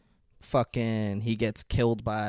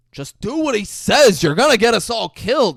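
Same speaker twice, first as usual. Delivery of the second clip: panicked manager; 11 semitones higher, 11 dB louder than usual. Retold or imitated. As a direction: beg him to comply, voice pitched up in panic. Manager pleading during robbery.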